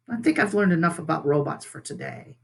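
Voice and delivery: usual speaking voice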